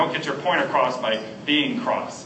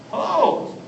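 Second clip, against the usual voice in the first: hello pitched way up, drawn out; high sing-song hello; 13 semitones higher than usual. Shown as a demonstration. Showing the ways to say hello, high and sweet. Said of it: "all the ways you can say hello".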